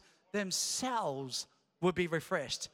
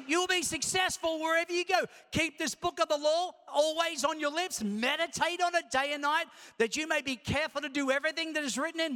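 higher, louder preaching of the verse; preaching scripture; 7 semitones higher, 4 dB louder than usual. Acted. Pastor preaching the verse at full force. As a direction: proclaim it to the church like a promise.

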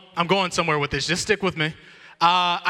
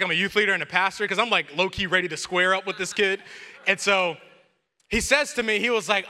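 ordinary delivery

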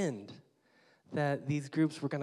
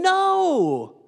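emphatic no; 11 dB louder than usual. Real, not acted. Preacher rejects the demand.

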